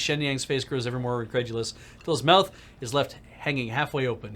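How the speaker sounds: ordinary talk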